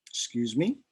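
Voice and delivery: ordinary delivery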